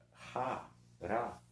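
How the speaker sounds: RA with a breathed R, then RA with a tapped R; contrasting R sounds. Shown as a demonstration. Teacher contrasts similar sounds for the class, two R sounds. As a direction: sound out each "ra" slowly and separately. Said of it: "como são sons parecidos".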